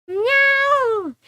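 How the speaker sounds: high, long drawn yowl; wild meow. Pretend. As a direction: yowl like a frenzied cat. Cat goes wild.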